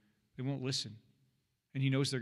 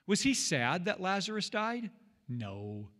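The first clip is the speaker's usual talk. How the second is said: ordinary talk, nothing marked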